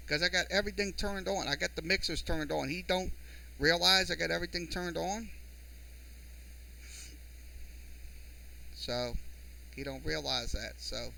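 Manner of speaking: hushed grumbling to yourself; muttered aside. Real, not acted. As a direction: complain softly, as if off to the side. Grumbling aside about his equipment.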